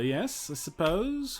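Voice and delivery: ordinary talk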